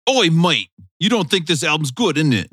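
put-on lightly done cockney British accent, jokey; hint of a British geezer accent, teasing; mock British accent. Host mocks his co-host in a light British accent. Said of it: "things that I could say in a British accent".

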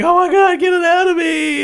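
high, loud panicked squeal; mock freaking out. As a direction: shriek it like you're panicking. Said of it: "he starts freaking out".

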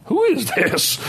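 high-pitched incredulous question; mock disbelief. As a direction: act baffled by who's talking, pitch jumping up. Mock disbelief at friends' advice.

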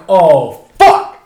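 loud acted "oh, fuck"; mock dread, punched out; dismayed realization. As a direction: groan it out as the bad news lands. Retold dread at driver's parting words.

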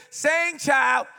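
encouraging shout. Retold or imitated. Church woman shouting encouragement to a bad singer. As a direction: call out loud and high like a church mother. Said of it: "you hear somebody say".

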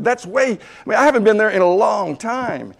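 high-pitched comic objection; acted-out aggrieved patient; incredulous protest. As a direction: plead how absurd this is. Retold protest at weight target.

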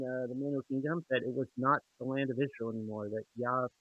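muffled, as if through a hand. Real, not acted. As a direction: voice dampened and muffled. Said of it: "You're, uh, you're muffled a bit".

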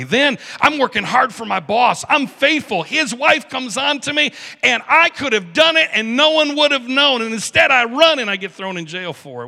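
high, loud, aggrieved outburst; indignant protest. Acted. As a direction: argue your innocence with mounting force. Joseph protesting his unfair treatment.

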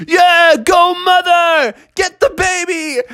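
high, drawn-out cheer; root like a rowdy sports fan; high-pitched egging-on holler; cheering crowd. Acted. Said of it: "they were like".

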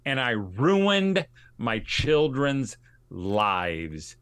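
very slow, with "ruined" stretched out and punched; emphatic. Retold or imitated. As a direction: press hard on "ruined", then leave "lives" hanging on its own. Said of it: "said it just like I just said it".

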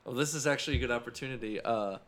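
ordinary delivery

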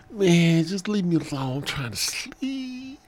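very high whiny protest; sleepy Willie grumbling. Acted. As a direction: whine to be left alone, fading off. Willie grumbles back, wanting sleep.

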